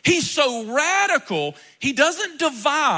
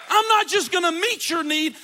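normal talking voice

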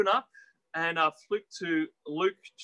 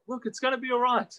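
higher-pitched mock reassurance; complacent reassurance; 7 semitones higher than usual. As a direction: brush off the danger, voice lifted. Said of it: "Do you hear people talk like that".

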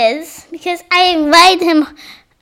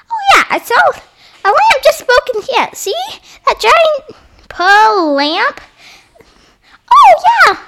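high toy voice; 9 semitones higher than usual. Pretend. Toy character finds broken lamp.

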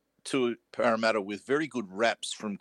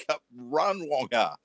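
higher-pitched mock yell at a player; retold yelling; 3 semitones higher than usual. Commentator retelling how both yelled to the winger, mock yell. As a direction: call out a run, imitating a yell at modest volume.

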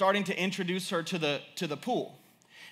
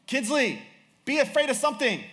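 raised, high-pitched mock pleading; exasperated parental plea; 8 semitones higher, 4 dB louder than usual. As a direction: beg a fearless toddler in comic exasperation. Nervous father calls to fearless toddler.

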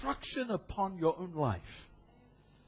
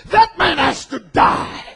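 much higher, loud shouted verdict; self-righteous outburst; 10 semitones higher, 9 dB louder, slower than usual. Retold or imitated. David pronouncing judgment on the rich man, furious shout. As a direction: bark out the sentence as an outraged king. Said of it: "in his self-righteousness and said".

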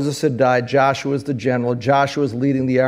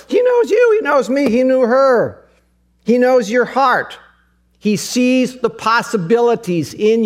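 high, loud, near-tearful preacher's cry; fervent sermon climax; impassioned preaching; 9 semitones higher, 4 dB louder than usual. Acted. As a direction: preach it with a breaking voice. Emotional preaching peak, voice cracking.

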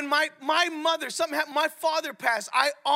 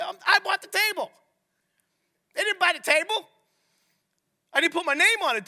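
indignant retort; 7 semitones higher, 5 dB louder than usual. Retold or imitated. Son retelling retort to mother.

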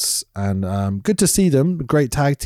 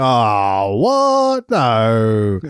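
exaggerated disappointed whine; mock dismay; much slower than usual. Host retelling his reaction to the reveal, mock dismay.

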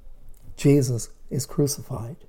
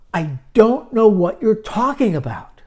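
loud denial; 7 semitones higher, 8 dB louder than usual. Acted. Peter's third denial.

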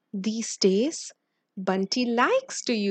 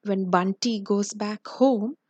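plain reading voice

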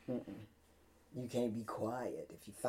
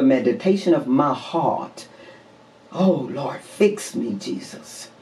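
preacher's prayer; 13 dB louder than usual. Real, not acted. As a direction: intone it as a fervent prayer from the pulpit. Opens with prayer.

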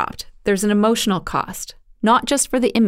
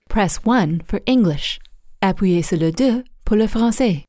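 imitating a bilingual phone menu; automated switchboard voice. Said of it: "answered by an electronic switchboard".

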